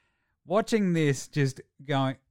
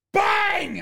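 shouted bang; 5 dB louder than usual. Retold or imitated. Fan acting out the hit.

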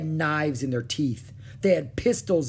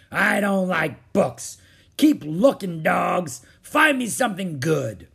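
loud, raised, growly pirate orders; angry pirate captain; 5 semitones higher, 6 dB louder than usual. Acted. Captain Bones to his searching men, angry pirate. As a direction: grumble and bark at the crew.

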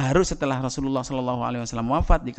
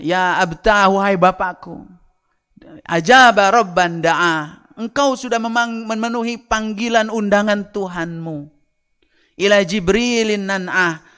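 mourning poem; 8 semitones higher, 6 dB louder than usual. Acted. Fatimah mourns her father in verse, loud high lament. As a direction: pour out grief for a lost father. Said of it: "Fatimah kesedihannya itu diungkapkan dengan membuat syair".

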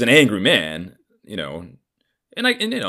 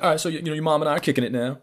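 quick, higher-pitched announcement; imitated father; 9 semitones higher, much faster than usual. Dad telling son about him and mom, quick high imitation. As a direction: mimic a casual dad, pitched up. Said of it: "He's like".

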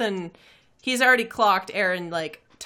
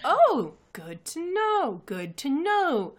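slow, higher-pitched drawn-out acknowledgement; boss in character; 6 semitones higher, slower than usual. Acted. Boss answering the would-be customers, slow high character voice.

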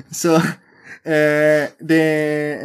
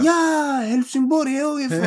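much higher, gleeful recognition; imitating excited cop; 13 semitones higher, slower than usual. Mimicking the cop thrilled to know HIF, much higher. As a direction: squeal it like a delighted fan.

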